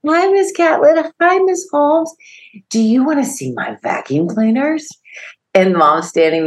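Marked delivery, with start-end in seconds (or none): 0.1-4.9 put-on high-pitched excited child voice; eager kid showing off, much higher; imitated eager little boy. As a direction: greet visitors like a thrilled five-year-old. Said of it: "he runs to the door and he goes".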